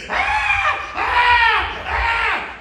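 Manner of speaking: high, loud mock screams; children's screams. Bus children screaming, imitated high screams. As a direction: scream like terrified kids.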